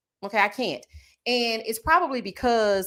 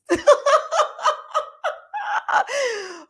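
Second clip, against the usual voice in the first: high shrieking cackle. Real, not acted. Cracking up over Nene's read to Kim.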